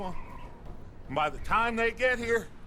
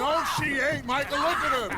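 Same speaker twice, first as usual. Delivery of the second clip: angry retort; 6 semitones higher than usual. Acted. Arguing with Micah over woman.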